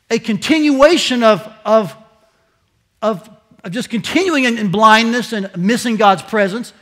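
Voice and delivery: loud, high pulpit preaching; preaching at full force. Acted. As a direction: press the point hard. Preaching climax.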